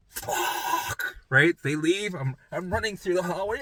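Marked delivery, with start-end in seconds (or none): 0.1-1.2 breathless whispered fuck; relieved whispered swear; whispered curse. Retold or imitated. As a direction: let it out in a whisper. After mom leaves.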